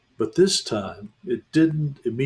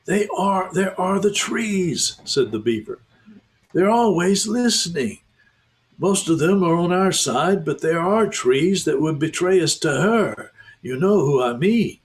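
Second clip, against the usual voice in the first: anxious beaver; 6 semitones higher than usual. Acted. Beaver explains danger.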